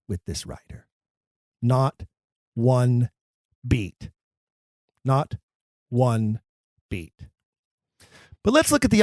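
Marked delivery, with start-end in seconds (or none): 1.6-7.3 slow emphatic repetition; each word separated. Shown as a demonstration. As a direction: spell it out word by word. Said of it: "nothing is arbitrary".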